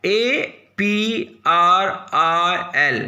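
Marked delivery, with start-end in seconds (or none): none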